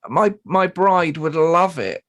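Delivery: high squeaky mock groom voice; mock him in a silly high pitch; taking the piss out of the groom's request; mimicking the groom. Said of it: "his words were".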